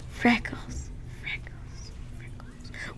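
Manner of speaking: fading whispered echo. Pretend. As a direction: let it echo away.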